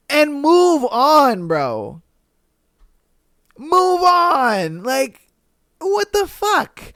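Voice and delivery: high, slow, emphatic plea; exasperated. Real, not acted. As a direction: drag it out high, fed up. Fed up with poster's overreaction.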